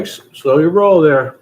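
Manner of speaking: loud mock warning, high and punchy; hammed-up loud warning from friends; friends telling him to ease up. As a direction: bark it out like a friend reining you in. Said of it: "they're like".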